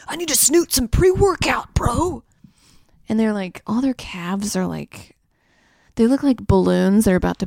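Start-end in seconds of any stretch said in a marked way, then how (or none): 0.1-2.2 higher, lightly voiced gym bro; mock gym bro. Imitating a gym bro hyped about pre-workout. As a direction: play a pumped-up bro lightly.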